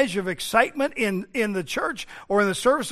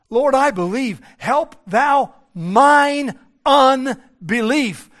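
raised cry, slowing to spaced words; earnest plea; 6 semitones higher, 7 dB louder, much slower than usual. Retold or imitated. Preacher voices broken father begging Jesus, earnest slowing plea. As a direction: plead it loudly, then space out "Help thou mine unbelief".

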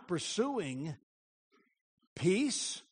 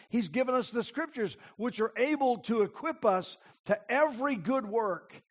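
rising, louder preacher's exhortation, pressing every phrase; full-force preaching; 6 semitones higher, 5 dB louder than usual. Acted. Preacher urging the congregation toward holiness, full force. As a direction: preach it hard, driving the point home.

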